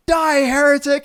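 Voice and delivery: sudden high, loud cry of "Die, heretic"; hammed-up zealot yelling his verdict; sharp high mock battle cry; mock shout. Punchline of joke, killer's line.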